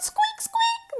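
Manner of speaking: very high tiny squeaks; piping mouse squeaks. A narrator's reading. Mouse joins the chain.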